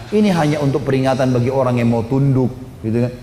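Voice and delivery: normal talking voice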